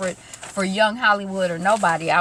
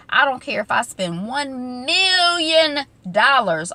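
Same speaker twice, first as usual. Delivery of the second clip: slow, higher, with MILLION drawn out; mock defiance; 5 semitones higher, 4 dB louder, slower than usual. Dad refusing to pay mom, drawn-out mock defiance. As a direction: imitate a defiant declaration, stretching "million". Acting out dad's defiant declaration.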